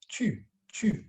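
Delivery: tu with lips pursed as if to whistle; high, tight French u in tu. Shown as a demonstration. Teacher showing pupils her lip shape, whistle-lipped tu. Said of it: "pursing my lips a bit".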